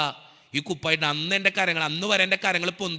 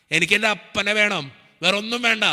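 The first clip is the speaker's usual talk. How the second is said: raised, insistent plea to God; retold desperate prayer, intensifying; pleading prayer; 4 semitones higher, 4 dB louder than usual. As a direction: beg God, voice lifting with urgency. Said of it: "ഞാൻ പ്രാർത്ഥിച്ചത് ഇങ്ങനെയാ".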